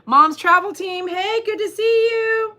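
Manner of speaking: high, singsong welcome; real, gushing hello; excited greeting. Real, not acted. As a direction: greet a newcomer in a delighted high voice. Calling out greeting to arriving viewer.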